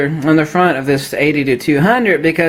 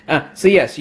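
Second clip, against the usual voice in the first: ordinary delivery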